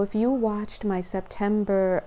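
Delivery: ordinary delivery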